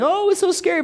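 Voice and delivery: high squealing crowd voice; mock scared crowd cry. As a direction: play the thrilled riders shrieking.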